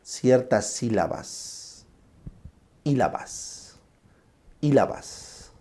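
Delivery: sílabas repeated slowly and trailing off; dragged syllables. Shown as a demonstration. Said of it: "logoclonía es cuando arrastras".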